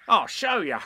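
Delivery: loud, high, swaggering tiger; brave Tigger. A narrator's reading. Tigger showing off to Roo, brave boastful voice. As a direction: puff it up with bravado. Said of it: "said Tigger bravely".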